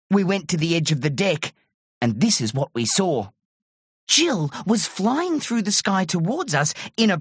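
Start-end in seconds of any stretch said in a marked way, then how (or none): none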